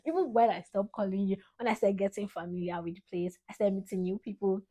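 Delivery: ordinary talk, nothing marked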